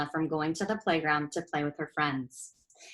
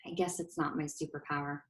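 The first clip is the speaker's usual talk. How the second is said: sad; 4 semitones lower, 12 dB quieter than usual. A narrator's reading. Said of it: "She sighed".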